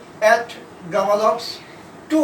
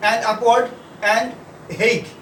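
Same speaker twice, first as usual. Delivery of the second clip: slow dictation; much slower than usual. Acted. Dictating sentences to a class.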